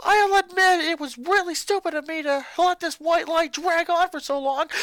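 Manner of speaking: put-on high, loud furious boy shouting at family; a boy exploding at his mother; angry boy ranting. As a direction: snap back at Mom, furious.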